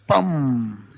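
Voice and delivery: slow popped pam with lips pressed; a slow exaggerated lip burst. Shown as a demonstration. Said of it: "Juntas los labios y haces un".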